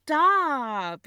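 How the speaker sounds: exclaimed objection; loud protest. Acted. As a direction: cut off a sibling's accusation loudly. Protests brother's accusation.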